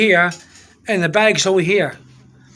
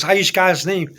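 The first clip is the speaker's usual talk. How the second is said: normal talking voice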